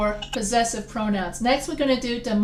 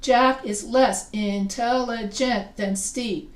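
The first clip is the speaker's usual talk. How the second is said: slow, "intelligent" broken into syllables; measured teacher model stretching "intelligent"; slower than usual. Shown as a demonstration. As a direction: sound out "intelligent" beat by beat. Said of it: "more than one syllable".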